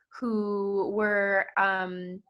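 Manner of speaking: ordinary delivery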